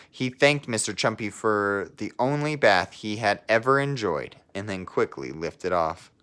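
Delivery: put-on lively read-aloud voice for children; storybook narration. Teacher reading a picture book to her class, storybook narration. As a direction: tell the story to a class of kids.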